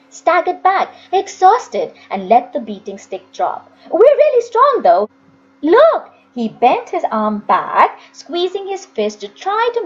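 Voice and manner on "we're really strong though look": put-on higher and louder, eager little boy. A narrator's reading. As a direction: try hard to impress the woman. Jim boasting eagerly.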